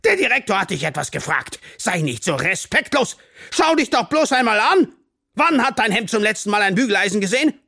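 loud, high, relentless dressing-down; angry scolding. A narrator's reading. Teacher scolds pupil about shirt, shoes and watch, angrily. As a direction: berate with mounting indignation.

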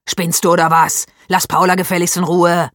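angry. A narrator's reading. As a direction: tell him off sharply. Boys quarrel in class.

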